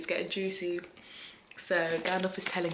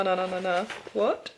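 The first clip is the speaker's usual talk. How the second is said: ordinary delivery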